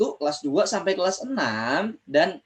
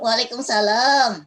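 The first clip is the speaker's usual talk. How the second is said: higher, slow puppet reply; puppet friend voice; 5 semitones higher than usual. Acted. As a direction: stretch it out in a high puppet voice. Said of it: "Temen Kak Ojan udah nggak sabar".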